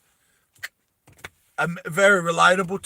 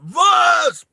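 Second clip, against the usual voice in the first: high, drawn-out shout; yelled name; 12 dB louder than usual. Acted. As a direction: yell the name across a distance. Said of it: "Hammy just yells out".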